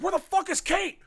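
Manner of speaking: high, loud acted alarm; panicked shout. Father finds his daughter is not here, panicked shout. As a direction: imitate a frantic father demanding answers. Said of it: "oh, he's panicking 'cause his daughter's not here".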